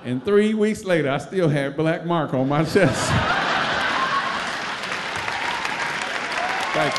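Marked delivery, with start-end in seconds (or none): 2.8-6.7 breathless high-pitched laugh; high squealing laugh. Real, not acted. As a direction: let out a squeaky wheeze laugh. Closing laughing fit.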